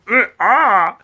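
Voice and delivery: imitating a tongue-bite wince; mock pained mm ah. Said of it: "I was like".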